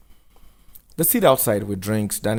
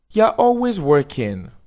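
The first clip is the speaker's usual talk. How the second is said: higher, louder, slow teasing woman; teasing Lena; 10 semitones higher, 7 dB louder than usual. Acted. Lena teasing Daniel over coffee, higher playful voice. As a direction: tease him about working too much.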